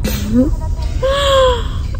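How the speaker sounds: groggy laugh. Real, not acted. Post-surgery giggle in car.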